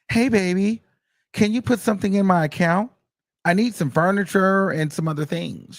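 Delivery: put-on high, sweet girlfriend voice; imitating her sweet-talking him for money; mock sweet request. Voicing woman asking [name] for money.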